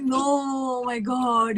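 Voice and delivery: slow, stretched cry of relief; a drawn-out overwhelmed exclamation. Retold or imitated. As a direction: let it pour out slowly, overcome. Overwhelmed reply on phone.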